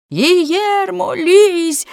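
high-pitched, insistent call; crowd's shout. A narrator's reading. Men ordering their comrade down, high collective shout. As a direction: cry it out like a whole group at once. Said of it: "закричали всі в один голос".

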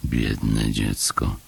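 low, slow sympathetic thought; pitying Paszczak. A narrator's reading. Paszczak pitying the disappointed child, low slow voice. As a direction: think it aloud, low and slow.

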